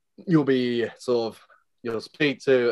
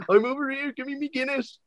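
very high-pitched Welsh caricature; high, hammed-up Welsh voice of a friend waving for a drink; mock Welsh accent. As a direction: squeal it in a put-on Welsh accent. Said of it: "Great Welsh accent".

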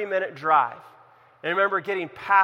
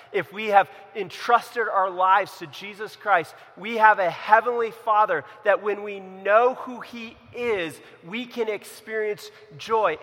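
rising, louder sermon build; full-force preaching; 4 semitones higher, 5 dB louder than usual. Acted. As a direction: preach it home to the congregation. Preaching climax.

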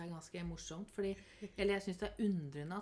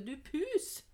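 høyt, lyst rop etter en katt; tilrop; 8 semitones higher, 5 dB louder than usual. Acted. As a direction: rop som om katten er på vei bort. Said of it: "så kan jeg ikke rope". (roper på en navnløs katt)

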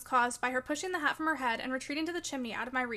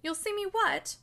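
high-pitched, startled question; surprised; 6 semitones higher, slower than usual. A narrator's reading. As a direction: ask it in offended disbelief. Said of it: "asked Catherine in surprise".

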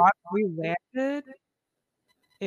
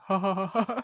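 wide-mouth fake laugh; 5 dB louder, faster than usual. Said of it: "they're just like".

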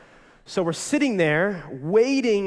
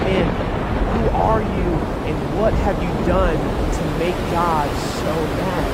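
louder, alarmed questioning; frantic sailors; 6 dB louder than usual. Acted. Sailors questioning Jonah, alarmed. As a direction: demand answers from Jonah. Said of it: "asking Jonah questions like".

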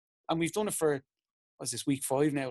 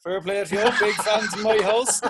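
slow, amused praise through laughter; laughing quote; 6 semitones higher, 8 dB louder than usual. Retold or imitated. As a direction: imitate the guard while laughing, louder. Guard praising him, retold laughing.

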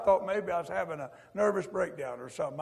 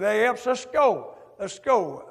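loud, slow hail of a buddy at the door; drawn-out "let's go" call; 3 semitones higher, 6 dB louder, slower than usual. Retold or imitated. War-hero friend calls Epps out for Saturday night, loud and slow.

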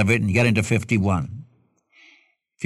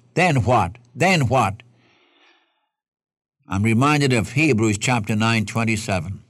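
usual speaking voice

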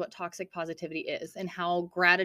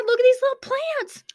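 high, squealy delight at little plants; excited squeal, much higher; cooing over cute plants; 7 dB louder than usual. Real, not acted. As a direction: gush over something adorable.

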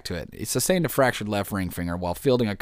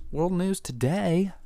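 announcer voice; 7 semitones higher, slower than usual. Acted. Announcing world news segment.

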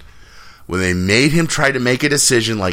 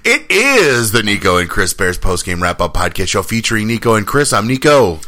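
podcast intro; 4 dB louder than usual. Acted.

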